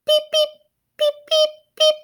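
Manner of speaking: very high, sleepy little peeps; chick peeps. Acted. Warm sleepy chicks peeping in the incubator, tiny high peeps. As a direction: peep like a drowsy baby chick. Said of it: "said Pecky".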